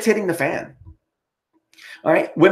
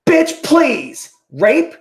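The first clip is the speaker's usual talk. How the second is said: high, scornful bitch please; scoffing; 11 semitones higher, 4 dB louder, much slower than usual. Real, not acted. Ranting vlogger scoffing at the listed accusation, scornful. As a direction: brush the idea off with contempt.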